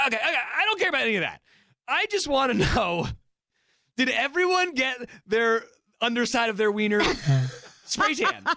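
high-pitched, breaking into laughter; imitating obsessed brother-in-law. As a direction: play him desperate to know. Brother-in-law's urgent question acted out.

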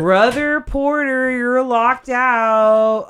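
high, slow and loud call, mock alarm; shocked call to a friend who is locked out; gasping surprise. Real, not acted. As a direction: gasp and call out to him, drawn out.

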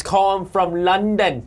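a slightly put-on London accent, drawn out slowly; hammed-up British accent from London; mock London accent. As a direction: try a bad London accent as a joke. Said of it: "How you like my London accent?".

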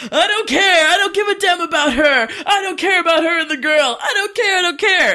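high, loud repeated outburst; mock yelling. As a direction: yell it in over-the-top protest.

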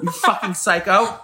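high-pitched, laughing mock outrage; drawn-out, high comeback, amused and appalled; incredulous retort. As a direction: act out stunned disbelief through a laugh. Retold incredulous comeback to friend.

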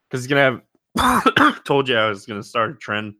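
real cough, then sheepish comment; coughing fit. Real, not acted. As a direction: cough cuts off the sentence. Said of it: "Sorry for coughing in the mic".

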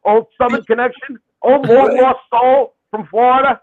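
loud, high-pitched teasing challenge; hammed-up, high and loud grilling; mock confrontation. Real, not acted. Hamming up challenge to old pal.

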